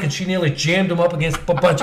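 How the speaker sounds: ordinary delivery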